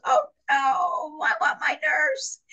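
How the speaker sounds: very high, exaggerated plea; mock panicked wail. Patient calling back her departing nurse, mock panicked wail.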